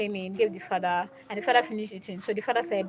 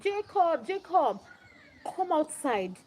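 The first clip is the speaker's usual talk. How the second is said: higher-pitched mother calling out; Rebecca; 7 semitones higher, slower than usual. Acted. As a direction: call a son to come out, urgently. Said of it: "Rebecca started calling Jacob".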